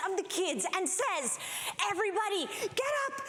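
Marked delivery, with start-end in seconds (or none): 1.9-3.2 high, urgent call to get everyone out; urgent rally. Retold or imitated. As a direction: hurry the kids out like there's a fire. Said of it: "as though there was a fire".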